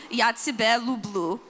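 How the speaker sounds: slow, hammed-up Russian accent; deliberately terrible Russian accent for the couple; bad Russian accent. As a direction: say it like a clumsy Russian speaker. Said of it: "in my very bad Russian accent".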